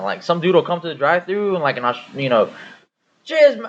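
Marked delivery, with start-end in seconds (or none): 3.2-3.7 high-pitched mock Australian greeting; put-on Aussie customer; Australian accent. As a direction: break into an Australian accent, then falter. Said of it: "like in a Australian accent".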